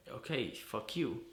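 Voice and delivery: angry defiance. Acted. Answering the brother who shut him out, defiant. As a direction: throw it out slowly and hard.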